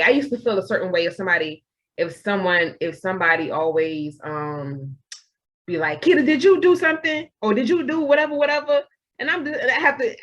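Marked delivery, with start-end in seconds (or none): 6.0-8.8 higher-pitched mock interrogation; accusing questioner. Someone questioning Kita over what she did, mock accusing. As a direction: imitate someone quizzing you, voice pitched up.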